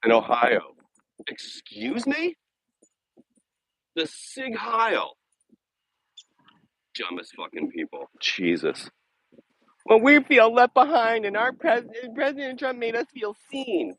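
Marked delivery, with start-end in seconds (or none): 1.3-5.1 high, slow, incredulous; mock disbelief. Vlogger reacting to news of Nazi salutes, mock outraged disbelief. 9.9-14.0 high, put-on whiny voice; mocking Trump supporter. Vlogger mocking Republicans' excuses, whiny parody voice.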